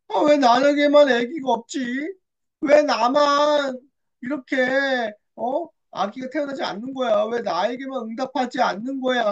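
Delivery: plaintive complaining. Acted. Childless Hannah asks why only her, plaintive complaint. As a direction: lament as if singled out unfairly.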